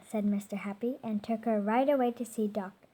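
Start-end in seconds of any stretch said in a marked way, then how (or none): none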